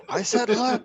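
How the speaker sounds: loud scolding through laughter; laughing scold. Acted. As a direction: snap the order while laughing. Player scolding familiar mid-laugh.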